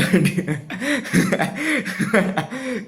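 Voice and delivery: high burst of real laughter. Real, not acted. Laughs before telling story.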